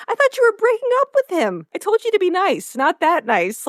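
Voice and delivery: high, indignant scolding; exasperated Rory. Retold or imitated. Rory scolding Lorelai for kissing the teacher, high and indignant.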